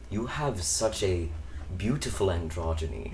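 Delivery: put-on slow, low voice with a slight Hungarian accent; calm, deep and unhurried reassurance; deep-voiced Hungarian professor. Professor calming a panicking student coming out, slow deep accented voice. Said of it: "his voice was very deep".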